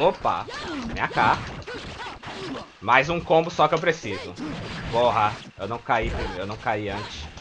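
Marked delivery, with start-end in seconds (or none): none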